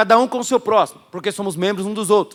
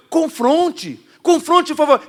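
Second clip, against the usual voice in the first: confronte, confronte, rising louder and higher; urgent confronting call; 8 semitones higher, 4 dB louder, much slower than usual. Acted. Preacher urging the crowd to confront, loud and high. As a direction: push them to confront.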